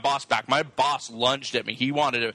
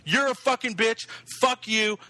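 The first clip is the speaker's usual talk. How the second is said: higher-pitched reenacted angry cussing; retold cursing; 7 semitones higher than usual. Retelling how he cussed out coworkers, higher angry voice. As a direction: act out cussing people out, pitched up. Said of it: "I was fucking just cussing at people".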